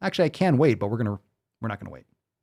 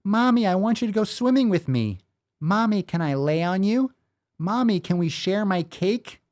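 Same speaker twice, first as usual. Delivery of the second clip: put-on high-pitched little kid; child's voice; 7 semitones higher than usual. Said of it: "read it in the voice of a child".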